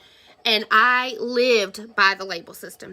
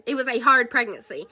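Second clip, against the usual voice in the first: ordinary delivery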